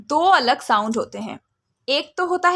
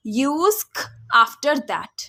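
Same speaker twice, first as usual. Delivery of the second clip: rule stated slowly; much slower than usual. Shown as a demonstration. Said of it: "stating the rule".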